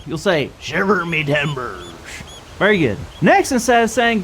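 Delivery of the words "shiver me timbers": pirate voice. Shown as a demonstration. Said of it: "to talk like a pirate".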